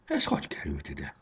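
low, soft mumble to oneself; muttered. A narrator's reading. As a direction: mutter it under your breath, puzzled. (halkan motyogva)

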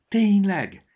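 high, loud, eager question; excited squeal of a question; perking up. A narrator's reading. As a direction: come suddenly alive with hope. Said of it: "elevenedett meg a nyuszi".

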